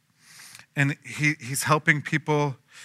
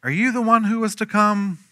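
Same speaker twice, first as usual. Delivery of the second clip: questioning; 9 semitones higher than usual. Retold or imitated. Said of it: "sent his disciples to ask him".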